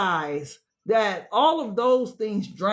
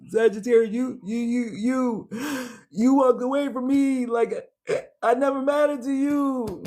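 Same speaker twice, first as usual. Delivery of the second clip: higher, stammering "you, you, you", close to tears; hurt plea; 4 semitones higher than usual. Acted. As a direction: accuse the one who walked away, wounded. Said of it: "want to say it like that so bad".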